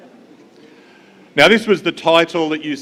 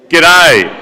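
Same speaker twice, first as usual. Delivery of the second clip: pinch your nose and call "G'day" with a twang; nosy Aussie twang; 3 semitones higher, 11 dB louder than usual. Shown as a demonstration. Whole room mocks the Australian twang, noses held: pinched nasal G'day. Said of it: "you have to hold your nose".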